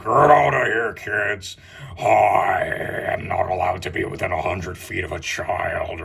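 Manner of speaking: a gruff put-on drunk Santa voice. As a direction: shoo the kids away like a sloppy mall Santa.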